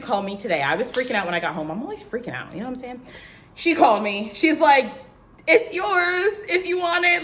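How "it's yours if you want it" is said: high, put-on voice announcing good news; excited job offer. Recruiter calls to offer the job, high excited imitation. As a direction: imitate a caller delivering happy news.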